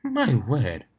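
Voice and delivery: usual speaking voice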